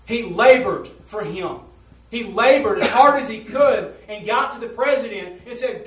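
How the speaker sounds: loud, high preaching build; fervent preaching. Acted. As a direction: drive the sermon home. Preaching at full force.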